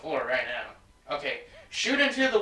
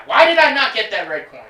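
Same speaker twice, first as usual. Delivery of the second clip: loud, higher-pitched complaint; exasperated outburst; 4 semitones higher, 9 dB louder than usual. Real, not acted. Gamer missing the red coin, exasperated outburst. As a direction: protest loudly at the game.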